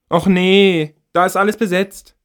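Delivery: put-on a teen girl finding every stall taken; annoyed teenage girl. A narrator's reading. Girl arriving at full toilets.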